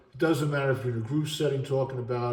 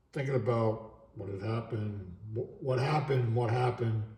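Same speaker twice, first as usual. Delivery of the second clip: usual speaking voice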